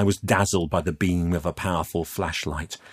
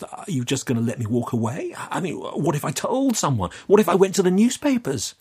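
high, climbing, agitated questions; anxious challenge; 10 semitones higher than usual. Acted. Youth threatens captor with exposure, agitated. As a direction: gather nerve, speeding up at the end.

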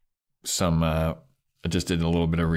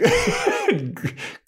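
mock villain laugh. Spike's laugh, retold.

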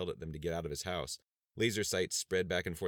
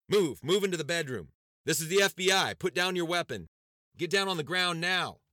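shouted commands, much higher and louder; barked orders; 12 semitones higher, 9 dB louder than usual. A narrator's reading. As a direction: yell commands like a raid team.

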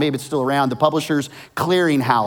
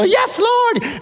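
far higher, ecstatic thanks; excited praise. Retold or imitated. Winner thanks the Lord for the money, ecstatic. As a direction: cry out in delight. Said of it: "And they're excited".

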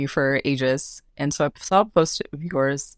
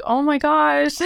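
high-pitched, touched gasp of words; overwhelmed reaction; 10 semitones higher, slower than usual. Retold or imitated. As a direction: retell being overwhelmed, pitch soaring into laughter. Acts her reaction to parents' pride.

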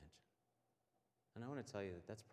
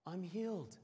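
higher, a touch of wonder; amazed discovery; 9 semitones higher, 5 dB louder than usual. Retold or imitated. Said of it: "looks at himself and says".

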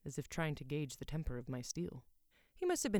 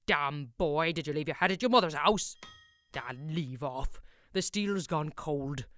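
loud scolding master smith; gruff blacksmith; 7 dB louder than usual. A narrator's reading. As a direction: bark orders at a slow apprentice.

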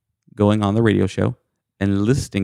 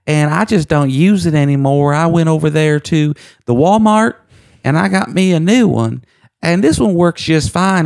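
put-on little old lady caller; 8 semitones higher than usual. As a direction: squeak out the sale like a sweet old Southern lady. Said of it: "a little lady. She'd be like".

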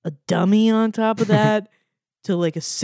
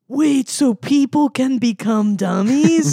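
slow, higher-pitched mock horror; horrified realization; 5 semitones higher, 4 dB louder, slower than usual. Podcaster re-enacting a child viewer's shock, mock horror. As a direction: stretch it out in dawning alarm.

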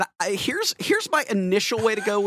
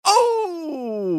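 high, sudden delighted "Oh!"; delighted cry; 12 semitones higher than usual. Real, not acted. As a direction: yelp with glee. Reacts to the pickup line.

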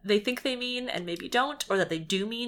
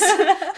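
burst of laughter; 11 semitones higher, 7 dB louder than usual. Real, not acted. Laughing at fic scene.

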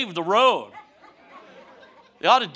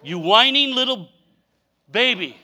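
louder, curt mock scolding; God's sharp rebuke, louder; stern rebuke; 2 semitones higher, 5 dB louder than usual. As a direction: scold like an impatient father. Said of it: "I'll repeat it".

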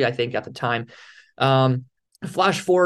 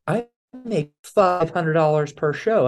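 slower, mock-proud brag; proud boast; slower than usual. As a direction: puff up and savor every number. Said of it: "And I'm all proud".

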